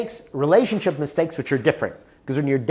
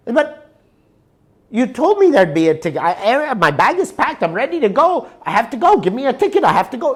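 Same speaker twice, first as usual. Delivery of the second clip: higher and louder, pressing and urgent; young tourist pleading with the clerk, higher and louder; desperate pleading; 9 semitones higher, 7 dB louder than usual. Retold or imitated. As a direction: beg for the ticket, bag already packed.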